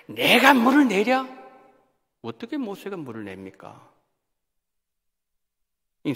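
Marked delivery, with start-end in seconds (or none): 0.1-1.3 high, loud and slow, scornful; angry Moses. Retold or imitated. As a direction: sneer it out like a furious leader. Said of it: "아주 화가 났어요".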